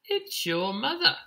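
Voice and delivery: high, rising bee voice; buzzing bees. Acted. Bees announce the chick's mother, high rising voice. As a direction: pipe the news up like a little bee. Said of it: "buzzed the bees".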